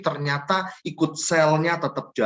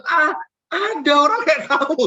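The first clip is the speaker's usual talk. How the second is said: much higher, incredulous jab; exasperated scold; 12 semitones higher than usual. Retold or imitated. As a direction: scoff at someone unbelievable. Scolding the sleeping employee. (menegur dengan heran, suara tinggi)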